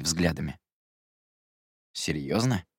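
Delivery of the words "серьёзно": mildly incredulous query; note of disbelief. A narrator's reading. Jerome questions the narrator's answer, slightly disbelieving. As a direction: ask it back with a touch of doubt. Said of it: "с ноткой недоверия в голосе переспросил".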